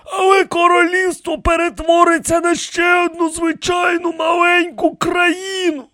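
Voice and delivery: very high protesting voice; alarmed protest. A narrator's reading. High priest warns the rebels, protesting. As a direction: warn them urgently in a thin, high register.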